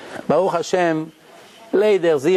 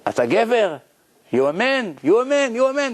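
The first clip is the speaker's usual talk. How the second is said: rising, taunting challenge, higher each time; mocking dare; 7 semitones higher than usual. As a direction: taunt him, pushing harder with each repeat. Workers daring the speaker, repeated.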